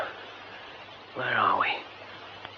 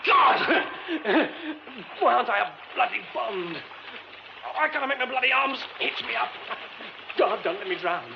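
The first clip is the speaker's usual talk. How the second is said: panicked cry; 6 semitones higher than usual. Acted. Fallen through stage, trapped.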